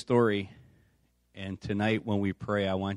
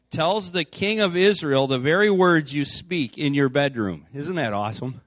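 raised, projected Bible-reading voice; scripture reading; 4 semitones higher, 6 dB louder than usual. A narrator's reading. Preacher reads the Elisha story to the congregation, projected scripture reading. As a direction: read it out emphatically to the whole room.

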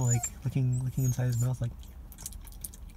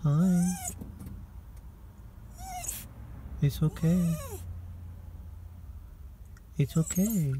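gentle, higher pet-soothing voice; soothing a scared dog; 9 semitones higher, 4 dB quieter than usual. Real, not acted. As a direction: comfort a crying puppy softly.

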